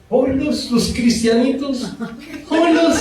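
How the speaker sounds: high, sneering neighbour voice; high taunting gossip; mocking gossip.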